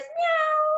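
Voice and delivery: a high cat meow. Acted. The cat meows at the puppy before boasting.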